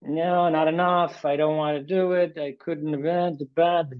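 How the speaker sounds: mock sulky self-talk; negative self-talk. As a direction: sulk like your inner critic. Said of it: "negative self-talk".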